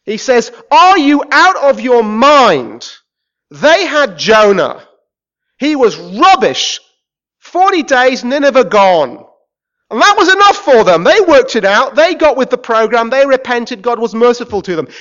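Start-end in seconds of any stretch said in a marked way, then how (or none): 0.7-9.2 loud, slow, exasperated challenge; Jesus rebuking the crowd, loud and slow; incredulous rebuke. Retold or imitated. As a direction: spell it out to fools. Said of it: "he says it in this way".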